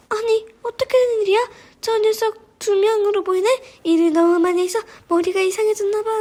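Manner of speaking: high, bewildered henchman voice; confused robber. A narrator's reading. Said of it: "혼란스러워하던 유적 도둑들".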